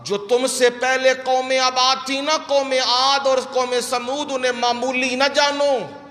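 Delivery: high, sustained declamation of scripture; emphatic preaching. Acted. Preacher proclaims God's words.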